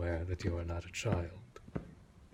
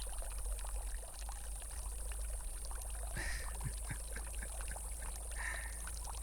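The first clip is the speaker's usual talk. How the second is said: drawn-out airy chuckle, much quieter; quietly delighted laughter; long soft laugh; 6 dB quieter than usual. Acted. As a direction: let a long hushed laugh roll out. Long laugh at own remark.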